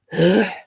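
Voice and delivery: loud, short exhale; heavy sigh. Real, not acted. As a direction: blow out the emotion. Gathering herself after crying.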